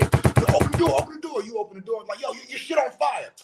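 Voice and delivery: high-pitched frantic alarm, acted out; urgent shouted warning. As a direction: yell it high like you're pounding on a door. Acting out warning of house fire.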